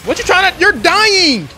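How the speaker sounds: high, loud gleeful yell; shrill loud taunt; gleeful shout. Real, not acted. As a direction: crow that the enemy is dying. Gloating at a dying enemy.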